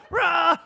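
loud, very high newborn wail; imitated baby scream. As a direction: screech like a crying baby. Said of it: "So he's screaming".

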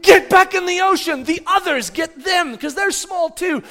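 high, loud, frantic shouting; urgent yelled order. Retold or imitated. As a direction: yell orders across the beach in an emergency. Father yelling orders, acted.

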